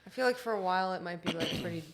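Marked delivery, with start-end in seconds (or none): 1.3-1.8 coughing mid-sentence; words broken by a cough; coughing fit. Real, not acted. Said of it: "Sorry, I'm coughing".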